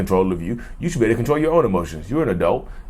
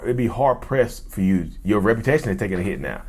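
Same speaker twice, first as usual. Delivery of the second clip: ordinary talk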